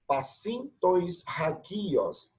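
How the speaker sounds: Pacintois ragios read slowly, with a breathy h before agios; slow syllable reading. Shown as a demonstration. Teacher modelling the rough breathing slowly. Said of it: "eu pronuncio ragios".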